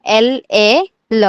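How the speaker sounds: एल ए ल in long, separate beats; spelled-out pronunciation. Shown as a demonstration. Teacher answering how to pronounce ल, spelled out slowly. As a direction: slowly spell एल, ए, then sound ल. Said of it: "कैसे pronounce करोगे आप".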